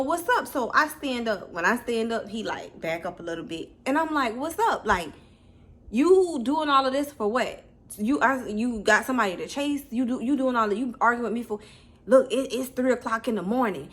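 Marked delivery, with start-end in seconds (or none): 0.0-0.5 high, challenging call-out; confrontational. Retold or imitated. Confronting him, standing up. 4.4-13.9 high, loud confronting; confrontational. Retold or imitated. Confronting him, standing up.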